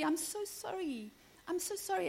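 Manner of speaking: soft, high, mock apology; sorry spouse. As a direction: plead meekly, a put-on grovelling apology. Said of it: "the spouse comes and they're so sorry".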